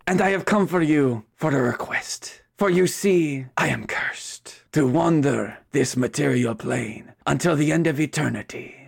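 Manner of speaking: put-on theatrical Spanish-accented chicken ghost. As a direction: introduce yourself grandly in a Spanish accent. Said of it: "[name] do a Spanish accent".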